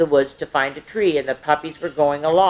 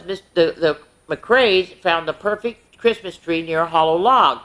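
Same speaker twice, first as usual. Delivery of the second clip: ordinary delivery, nothing marked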